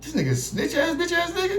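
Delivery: high laughing disbelief; laughing outrage. Retold or imitated. Learning the friend snitched: high laughing voice. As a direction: laugh it out in shock. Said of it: "echo laugh".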